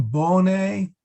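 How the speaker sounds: BONE with a drawn-out long O; sung-style long O in bone. Shown as a demonstration. Latin teacher showing how choirs usually sing it, long O. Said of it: "with a long O".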